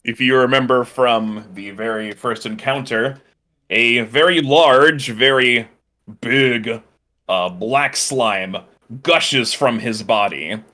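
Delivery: building dramatic scene setting; dramatic narration, slow on the big reveal; game master narration. A narrator's reading.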